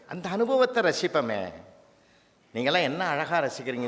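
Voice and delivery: ordinary talk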